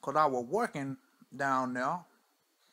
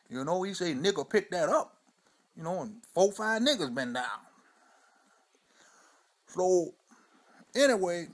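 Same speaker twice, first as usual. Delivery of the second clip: put-on old Mudbone; 3 semitones higher, 4 dB louder than usual. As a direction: spin the yarn like a weathered old man on the porch. Old storyteller character throughout.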